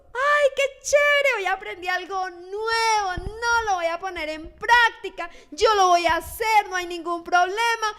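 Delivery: overexcited mock churchgoer, near tearful; gushing enthusiasm. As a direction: ham up a churchgoer thrilled by a sermon. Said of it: "la reciben con gozo".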